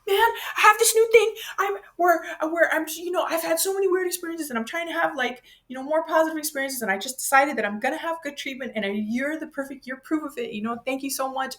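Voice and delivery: high, eager gushing thanks, a touch overflowing; gushing excitement. Retold or imitated. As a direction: pour out thanks to a stranger, bubbly and high. Gushing to helper, much higher.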